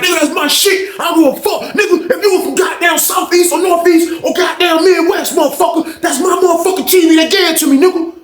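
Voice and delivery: very high-pitched furious rant; snapping out. Retold or imitated. Fonz defends his T V, imitated snapping out. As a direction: imitate him losing it, pitch way up.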